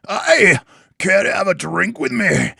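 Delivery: high, loud hammed-up Scottish barkeeper; bad Scottish accent. Barkeeper inviting an adventurer for a drink, bad Scottish accent. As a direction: call out in a badly faked Scottish brogue. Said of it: "in a bad Scottish accent".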